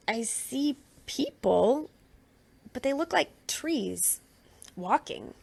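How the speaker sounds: slow, halting, amazed; slow wondering. Acted. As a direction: feel your way through each word. Blind man describing blurred sight.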